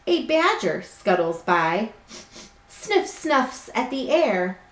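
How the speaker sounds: slow, lilting storybook voice; reading to children. A narrator's reading. Teacher reading a picture book to her class, gently storytelling. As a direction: read it like bedtime story time.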